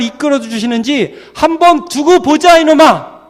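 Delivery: high, forceful retort; defiant confession of faith. Acted. Believer telling the mocker to wait and see, defiant. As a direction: build to a high, loud challenge. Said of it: "이렇게 믿음으로 고백하는 거예요".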